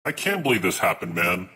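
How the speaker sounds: ordinary talk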